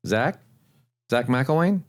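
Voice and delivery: "Zach" and "McElwain" rising like a question; curious lift at the end of "McElwain"; upward inflection. Shown as a demonstration. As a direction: ask "Zach McElwain" as if checking it's the right person. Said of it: "my upward inflection is like I'm asking".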